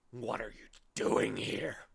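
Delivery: slow growling watchdog voice; growled. Acted. Watchdog challenging Milo, a slow growl. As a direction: growl it slowly, suspicious. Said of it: "growled the watchdog".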